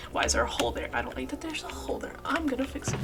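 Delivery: a whispered gripe about the hole; whispering. Real, not acted. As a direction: mutter it under your breath, barely voiced. Annoyed at a hole in build.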